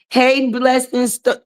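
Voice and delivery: normal talking voice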